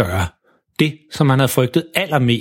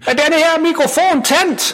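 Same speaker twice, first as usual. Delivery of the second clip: teacher calling high and slow into the mic; speaking loudly; slower than usual. Acted. Teacher testing the microphone before the concert, loud. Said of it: "siger meget højt ind i den".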